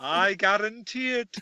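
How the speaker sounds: slow, drawn-out fake-father voice; Dark Helmet. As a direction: mimic a disguised villain promising too much, slowly. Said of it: "That's what this guy sounds like".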